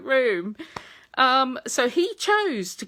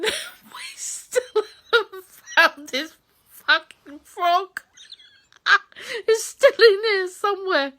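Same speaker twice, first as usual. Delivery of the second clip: laughing; 8 semitones higher, 4 dB louder, slower than usual. Real, not acted. Laughing tearfully.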